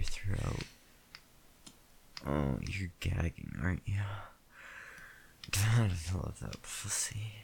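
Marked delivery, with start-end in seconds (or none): 5.5-7.3 whispering. Acted. Intimate whispered roleplay.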